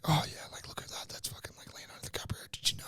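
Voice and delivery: hushed unvoiced chatter; imitating a whispering cinema neighbour; whispering moviegoers. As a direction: whisper like someone behind you in a cinema. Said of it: "there's people behind you going like".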